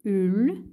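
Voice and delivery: "ul" with U first, closing on L; syllable "ul". Shown as a demonstration. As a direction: sound out "ul" alone, the L at the end. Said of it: "Ici, on a ul". (syllabe « ul » détachée, L final)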